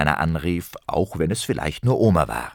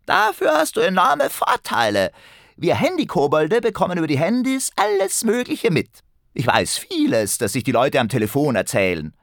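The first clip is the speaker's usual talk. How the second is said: boastful goblin; 10 semitones higher than usual. A narrator's reading. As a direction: show off like a little know-all goblin. Kobold answers Ben on the phone.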